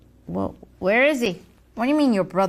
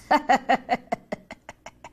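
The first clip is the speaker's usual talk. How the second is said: evil villain chuckle; evil laugh. Acted. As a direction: cackle like a cartoon villain.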